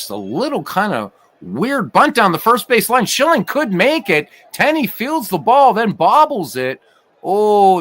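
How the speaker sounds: rising play-by-play alarm at the error; an announcer thrilled by a sloppy play at first; excited call. Acted. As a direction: voice climbs high and loud as the fielder bobbles it. Bunt and a bobbled ball.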